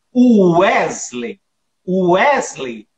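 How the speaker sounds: o Wesley dito devagar, marcando a sílaba tônica de Wesley; slow name stress demo. Shown as a demonstration. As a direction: separate each syllable of o Wesley slowly. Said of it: "por exemplo". (pronúncia lenta de Wesley, sílaba a sílaba)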